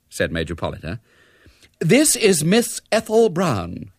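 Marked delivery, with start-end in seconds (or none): 1.8-3.9 slow, high, haughty announcement; extremely haughty. A narrator's reading. Ethel introduces herself on the telephone, extremely haughty. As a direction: announce yourself grandly, drawing it out. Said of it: "attractive but extremely haughty voice".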